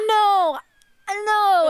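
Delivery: loud, high "no, no" from an outraged customer; angry customer. Retold or imitated. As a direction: shout it up high like a fed-up shopper. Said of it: "And they'd be like".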